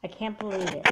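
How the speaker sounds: ordinary talk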